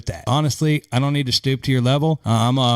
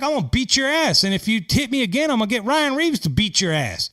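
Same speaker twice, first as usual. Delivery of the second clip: higher-pitched mock tough-guy threat, a touch of bravado; tough player threat; 7 semitones higher than usual. Imitating a player threatening an opponent on the ice. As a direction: bark it like a hockey enforcer. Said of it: "They're like".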